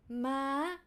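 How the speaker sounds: "Ma" rising to high, question-like. Shown as a demonstration. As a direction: lift "Ma" upward from mid. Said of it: "it's like when you say".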